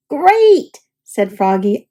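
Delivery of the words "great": high loud proud great. A narrator's reading. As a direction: burst out proud. Froggy answers cheerfully.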